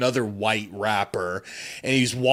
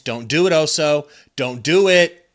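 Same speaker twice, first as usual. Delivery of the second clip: loud, rising shout at the player; anxious fan yelling at a shooter; urgent pleading; 4 semitones higher, 9 dB louder than usual. Real, not acted. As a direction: shout a warning, more urgent the second time. Pleading with player during live play.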